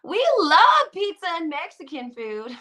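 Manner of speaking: high-pitched mock Southern twang; Southern accent. Imagined houseguest buttering up Joe, high Southern accent. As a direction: gush in a hammed-up Southern voice. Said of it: "that's my southern accent".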